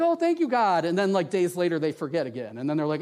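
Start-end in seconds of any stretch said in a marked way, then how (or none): 0.0-0.8 high, gushing imitation of grateful people; mock gushing relief. Preacher imitating the Israelites after God provides, mock gushing. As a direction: act out exaggerated thankfulness, pitched way up.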